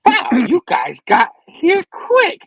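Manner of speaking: high, loud, drawn-out comic villain voice; Goblin Spidey. Retold or imitated. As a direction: exclaim it slowly, way up in pitch. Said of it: "Goblin Spidey's like".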